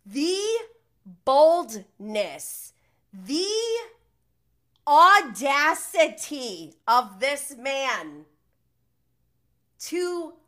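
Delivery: halting, emphatic outrage, word by word; loud and incredulous, slowing to a stop; exasperated disbelief. Real, not acted. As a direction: punch out each phrase with long appalled pauses. Own exasperated rant at his nerve.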